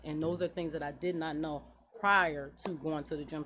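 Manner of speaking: normal talking voice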